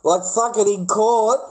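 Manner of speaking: high, slow disbelief; incredulous. Real, not acted. Vlogger stunned his meme videos were used in court, incredulous. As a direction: stretch it out in disbelief.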